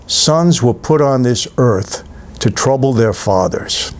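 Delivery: slow, grave quoting of the father's line; Paul Newman's line. Retold or imitated. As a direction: lean into it slowly like a weary old mob boss. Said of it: "And he says".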